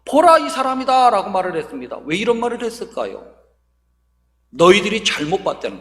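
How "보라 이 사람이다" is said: raised-pitch declaration to the accusers; Pilate proclaiming. Retold or imitated. Governor addressing the accusers, proclaiming. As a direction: announce it high, leaning on the first word. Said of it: "대장과 유대인들을 향해서".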